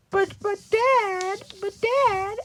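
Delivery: very high, drawn-out whine; hammed-up high-pitched wail; mock sad pleading. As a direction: plead in a falsetto like a hurt child. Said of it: "being all sad".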